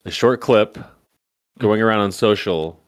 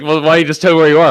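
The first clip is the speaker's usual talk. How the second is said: rattled off fast, louder and higher; fast nagging; 6 semitones higher, 7 dB louder, much faster than usual. Retold or imitated. Wife pressing husband for his location, fast and louder. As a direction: rush it like a pushy wife. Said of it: "she's like".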